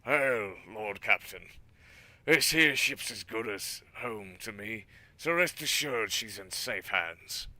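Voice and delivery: put-on gruff, loud old pirate. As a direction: growl it like a salty pirate. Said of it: "he's like a pirate voice".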